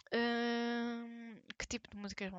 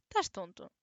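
louder telling-off to a pet; scolding the dog; 6 dB louder than usual. Real, not acted. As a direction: scold the dog, half laughing.

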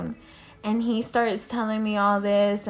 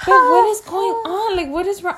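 mock whiny boyfriend; 10 semitones higher, 6 dB louder than usual. Boyfriend voiced, confused.

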